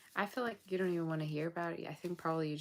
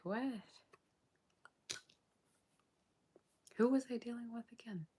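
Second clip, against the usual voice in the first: high breathy whisper, stunned at her own past; incredulous whisper; 7 semitones higher than usual. Retold or imitated. Reader voicing the viewer looking back, shocked whisper. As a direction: whisper it in disbelief, pitched up. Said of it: "look back at the past and be like".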